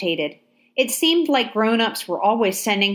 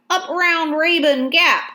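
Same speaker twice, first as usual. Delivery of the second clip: higher, louder Georgia country voice; Georgia accent; 8 semitones higher, 4 dB louder than usual. A narrator's reading. Soldier names his home to Abraham, Georgia twang. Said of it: "a Georgia accent".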